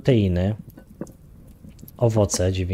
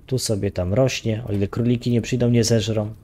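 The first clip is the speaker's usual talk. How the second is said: normal talking voice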